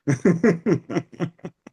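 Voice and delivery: high, loud amused laugh; burst of laughter. Real, not acted. Laughing at his own joke about accents, a high loud burst.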